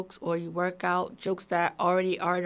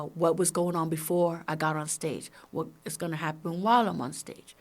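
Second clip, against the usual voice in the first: ordinary talk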